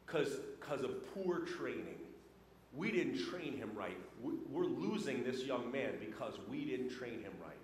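low, soft, a hint of a concerned manager; store manager. Store manager explaining to shift manager, low soft regret. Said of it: "She goes".

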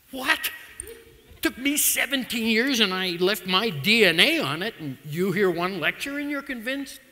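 loud, high disbelieving What; incredulous outburst. Retold or imitated. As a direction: burst out in shocked disbelief at mother. Son shocked at mother's verdict.

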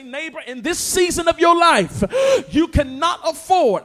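full-force Sunday preaching. Acted. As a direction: push the words out over the crowd. Preacher shouting at congregation.

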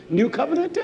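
usual speaking voice

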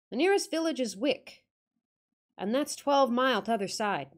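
put-on old northern guide, curt; Yorkshire accent. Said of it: "attempt the accent".

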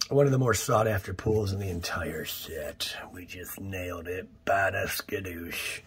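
soft unvoiced whisper; hushed triumph. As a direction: whisper the big pull to the camera. Hushed celebration of a big pull.

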